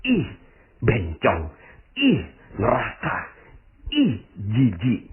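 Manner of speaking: drawn-out, slow mock disgust; slow scornful ih, ih, ih; disgusted sneer. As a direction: imitate a judgmental onlooker recoiling. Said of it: "ngomongnya gini".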